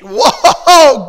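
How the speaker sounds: big, loud, high wow; amazed cheer. Real, not acted. Coach impressed by pupils' effort, loud amazed cheer. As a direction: whoop with admiration.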